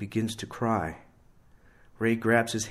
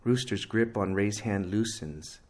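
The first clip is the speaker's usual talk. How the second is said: usual speaking voice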